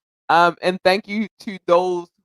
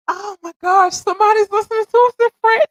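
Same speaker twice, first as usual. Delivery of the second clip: high, squealing disbelief; mock-giddy squeal of amazement; amazed squeal; 5 dB louder than usual. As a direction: gush like you can't believe it. Imitating her own amazed thought.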